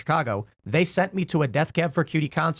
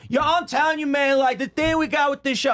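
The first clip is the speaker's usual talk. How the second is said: hyped-up on-camera persona; 11 semitones higher than usual. Retold or imitated. Guest switching to his camera persona, pumped-up high hype voice. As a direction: flip into a showman the instant the camera rolls. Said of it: "It was like Doctor Jekyll".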